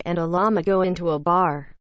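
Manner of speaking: ordinary talk, nothing marked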